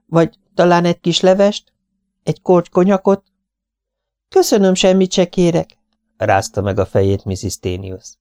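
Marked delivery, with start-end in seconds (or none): 0.0-3.2 high, fluttery spinster voice; fussy Miss Lawson. Acted. Miss Lawson offering soup to her guest, high and fussy. 4.3-5.8 high, gentle lady's voice; tired young wife. Acted. Mrs Tenius refusing food from Miss Lawson, high and polite.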